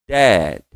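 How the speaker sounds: "Dad" with just a small tap on the last d; barely touch the final d of "Dad"; light final d. Shown as a demonstration. Teacher shows the light tap on dad. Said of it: "Só um toquinho no D".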